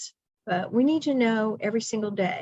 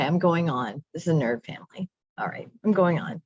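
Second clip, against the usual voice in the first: ordinary talk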